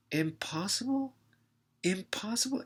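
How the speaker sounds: high, incredulous echo of a word; mocking disbelief. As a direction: throw the word back scornfully. Trainer mocks "impossible" claim.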